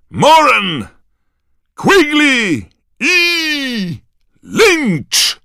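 very high, loud, stretched name calls; roaring announcer. A narrator's reading. Bagman calling out the Irish players, roaring. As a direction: shout each name like a stadium commentator. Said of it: "ryknął Bagman".

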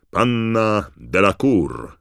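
loud, high, drawn-out name call; Bagman announcing. A narrator's reading. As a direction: summon the next contestant like a ring announcer. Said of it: "ryknął Bagman".

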